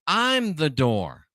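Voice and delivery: very slow, drawn-out quote; slow, deliberate scripture quote; solemn declaration. Retold or imitated. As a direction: stretch each word as a weighty pronouncement. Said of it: "now Jesus says".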